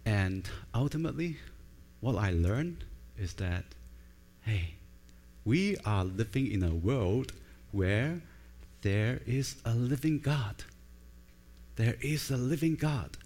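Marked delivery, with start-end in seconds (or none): none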